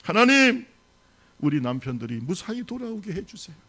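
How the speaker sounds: loud, high cry of 하나님 then a pleading prayer. Acted. Wives cry to God in the storm, pleading. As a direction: beg God to bring the husbands home. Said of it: "통곡하면서 울기 시작합니다".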